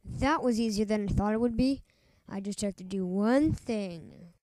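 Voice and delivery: normal talking voice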